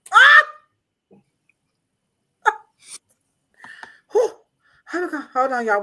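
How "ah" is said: high loud shriek of shock; startled shriek. Real, not acted. Shrieking as the hair images pop up. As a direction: shriek at the picture.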